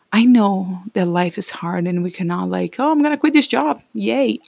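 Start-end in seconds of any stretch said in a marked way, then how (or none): 2.8-4.4 mock carefree quitter.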